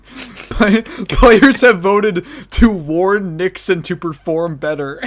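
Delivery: loud, slow announcer voice, drawn out; game announcer. Pretend. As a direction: boom out the vote result.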